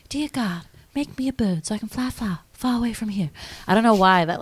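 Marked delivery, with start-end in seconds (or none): 0.1-3.3 put-on high, soft child's prayer; little prayer impression. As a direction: imitate a little girl praying quietly. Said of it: "that little prayer that's like".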